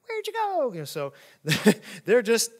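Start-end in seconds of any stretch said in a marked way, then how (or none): 0.1-0.7 very high, bewildered father calling after his sons; comic high-pitched call after the sons; abandoned father. Acted. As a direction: squeak it out like the left-behind dad. Father left in boat calls.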